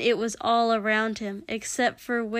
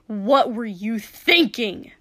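loud, slow, angry demand; spat in anger; 7 dB louder, slower than usual. A narrator's reading. Katsuki scolding his friend, angry. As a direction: spit it out, leaning on the words. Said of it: "Katsuki spat in anger".

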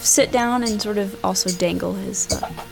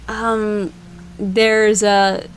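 ordinary delivery

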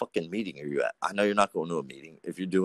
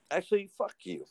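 mock insult; 13 semitones higher, 5 dB quieter than usual. Playful insult to cohost.

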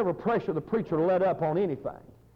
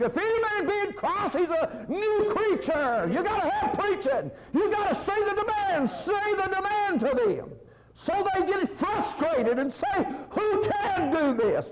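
full-force preaching; 11 semitones higher than usual. Real, not acted. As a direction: preach it high, stretching the key words.

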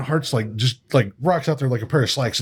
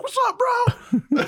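hyped bro greeting; slower than usual. Retold or imitated. Darby at the stranger's window.

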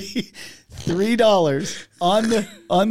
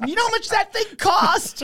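high, strained voice of an aggrieved friend; mock outrage. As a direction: squeal it in hammed-up indignation. Retold victim's outraged complaint about cost.

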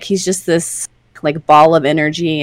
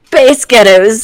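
higher, louder Scottish try; Scottish accent; 5 semitones higher, 7 dB louder, slower than usual. Shown as a demonstration. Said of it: "If you want to say Spice Girls with a Scottish accent".

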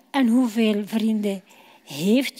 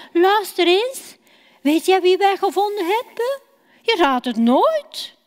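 eager, higher and a bit quick; bursting to share a secret; excited; 6 semitones higher, 3 dB louder than usual. Acted. Filippus telling Nathanael the big news, eager and excited.

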